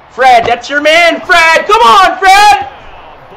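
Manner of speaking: loud urging shout at the screen; calling out. Real, not acted. Gamer shouting at his player on screen, loud urging call. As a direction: yell the player's name to push him on.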